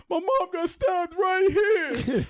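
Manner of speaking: high, slow, wailing imitation of a crying kid; mock crying plea. Retelling a kid begging his attackers, high mock crying. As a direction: whine it out like a kid begging not to be beaten. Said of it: "He's crying".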